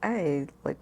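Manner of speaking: ordinary talk, nothing marked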